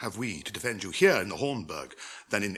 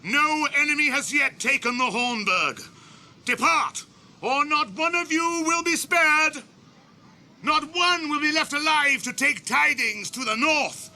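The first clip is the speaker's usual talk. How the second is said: loud, high ringing warning; stern call; 13 semitones higher, 3 dB louder than usual. Acted. Aragorn gives the orcs a last stern warning, calling loud. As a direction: warn them one last time.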